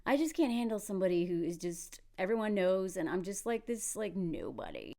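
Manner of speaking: imitating a mopey man, low and soft; mumbly self-pitying impression; insecure man. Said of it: "He's this insecure man who's like".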